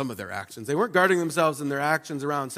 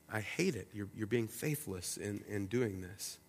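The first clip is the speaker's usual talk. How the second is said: hushed grieving God; 6 semitones lower, 13 dB quieter than usual. Acted. God voiced softly, grieved.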